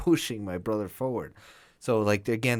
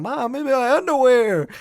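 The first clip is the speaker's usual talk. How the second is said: put-on high, louder whiny kid voice; pleading kid; 14 semitones higher, 9 dB louder than usual. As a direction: beg mom like a little boy. Kid pleading with mom during fire.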